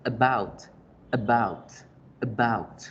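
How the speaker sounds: "about" with a weak schwa A and a strong, falling "bout"; schwa first syllable. Shown as a demonstration. Teacher modelling "about" for Spanish speakers, schwa then stressed "bout". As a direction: say "About" slowly apart, A reduced to "uh", weight on "bout". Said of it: "se dice como la schwa".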